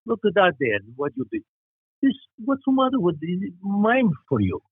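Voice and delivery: put-on Czechoslovakian grandfather's accent. Grandson imitating his grandfather scolding little Johnny, heavy Czechoslovak accent. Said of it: "this is my grandfather".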